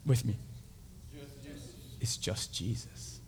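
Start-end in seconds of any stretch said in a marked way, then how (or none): 2.0-3.2 very soft, low and slow; hushed refrain. Shown as a demonstration. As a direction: lead them in a near-whisper. Leads congregation in hushed phrase.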